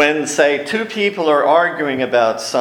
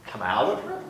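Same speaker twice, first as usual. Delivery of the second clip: quiet echo; 9 dB quieter, faster than usual. Retold or imitated. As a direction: let it fall away softly. He turns around and he says.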